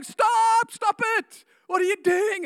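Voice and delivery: high, loud panicked stop; king screaming stop; frantic king. Acted. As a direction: cut him off mid-blessing, yelling. King stops Balaam.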